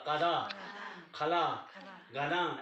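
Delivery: कदा, खला, गना each ending in a long open आ; listed one by one with full endings; final vowels kept. Shown as a demonstration. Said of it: "ऐसा होना था ना। तो ऐसा हमने नहीं किया".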